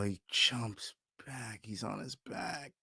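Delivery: fading to a near-silent groan; the air goes out of him; deflated muttering. Real, not acted. Fan watching his fighter fall, deflated mutter.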